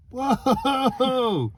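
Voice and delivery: high, loud delighted whoa; real burst of amazement; excited whoa. Real, not acted. Sees bright reverse lights.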